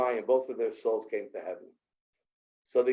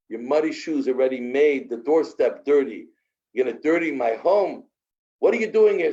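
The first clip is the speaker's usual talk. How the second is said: angry rich man; 3 semitones higher, 5 dB louder than usual. Acted. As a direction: bark down at the beggar on your doorstep. Rich man scolds poor man.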